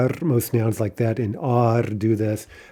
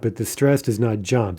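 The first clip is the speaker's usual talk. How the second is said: ordinary talk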